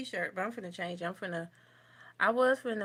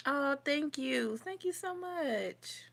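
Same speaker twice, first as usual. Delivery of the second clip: excited thanks; 7 semitones higher, slower than usual. Real, not acted. Thanking viewer for compliment.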